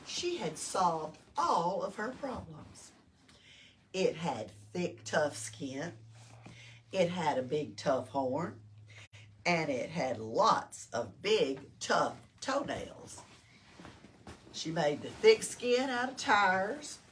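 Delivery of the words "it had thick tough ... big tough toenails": chunky rhino-strong storytelling voice; low emphatic reading. A narrator's reading. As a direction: lean low and heavy on each tough. Repeating rhino traits emphatically.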